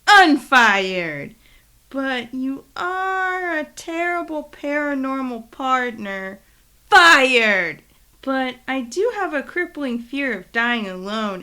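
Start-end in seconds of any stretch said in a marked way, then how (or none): 0.1-1.3 loud snap-back unfiring; mock shout of unfiring. 6.9-7.8 loud shouted firing; mock firing shout.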